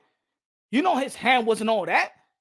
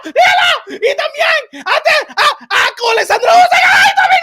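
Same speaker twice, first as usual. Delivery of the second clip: urging, building from raised voice to loud, high squeal; hammed-up ecstatic fan begging for more; mock excited fanboy; 8 semitones higher, 8 dB louder than usual. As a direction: escalate into a screaming fake climax. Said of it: "That's how they be".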